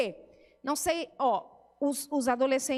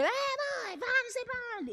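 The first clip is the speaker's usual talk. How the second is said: put-on much higher, quick bratty teen voice; high mock teen yell; 10 semitones higher, 3 dB quieter than usual. Mother imitating her teen brushing her off, high and quick. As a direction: mimic a sulky teenager brushing mom off.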